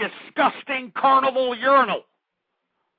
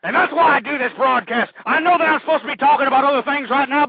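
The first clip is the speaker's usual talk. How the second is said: ordinary delivery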